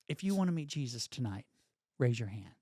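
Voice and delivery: retold altar call. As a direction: quote a preacher gently inviting the crowd. Front man's retold altar call.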